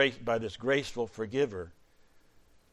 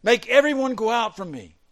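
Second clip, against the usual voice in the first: high, loud outburst; crying out; 10 semitones higher, 12 dB louder than usual. Acted. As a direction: cry out an order to the room. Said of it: "And he cried out".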